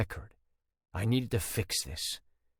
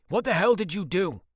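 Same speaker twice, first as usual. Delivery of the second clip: higher and louder, hitting hell; angry demand; 9 semitones higher, 5 dB louder than usual. Acted. Ford demands of Bentley what hell he did, angrily. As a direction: throw it at him, hitting hell. Confronting his brother again.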